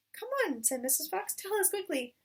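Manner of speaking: high, eager push; urging mother. Acted. Missus Fox urging husband to tell plan, eager. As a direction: hurry him up impatiently.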